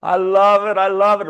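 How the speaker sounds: high, loud delighted burst; thrilled after the premiere; excited. Real, not acted. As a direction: gush with real delight. Excited reaction to the video.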